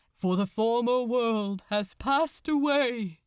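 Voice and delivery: solemn scripture intoned over the crowd; a priest reciting Revelation, steady and grave; intoning priest. Acted.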